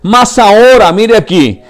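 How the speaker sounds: high, loud, impassioned sermon; preaching at full force. Acted. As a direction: pour it all out to the congregation. (predicación a todo pulmón, aguda y fuerte)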